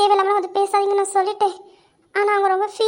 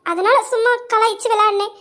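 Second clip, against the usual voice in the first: ordinary talk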